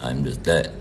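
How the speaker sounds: ordinary talk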